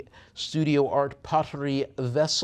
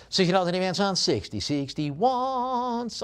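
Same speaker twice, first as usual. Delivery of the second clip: high, stretched mock-French call; mock French accent; 7 semitones higher, 5 dB louder than usual. Auctioneer calling bids on spoons, mock French accent. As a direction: draw it out in a playful French accent. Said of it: "I'm speaking in a French accent".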